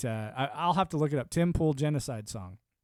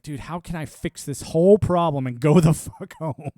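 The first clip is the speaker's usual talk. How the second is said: louder, laughing mock gripe; mock exasperation; 9 dB louder than usual. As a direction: gripe it out loud like a fed-up soldier. Voicing soldier's frustration.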